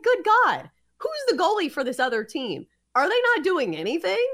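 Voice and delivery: high-pitched mock disbelief, punched at the start; baffled, high-pitched retelling of own reaction; incredulous retold outburst. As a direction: exclaim it in exasperated amazement, replaying the moment.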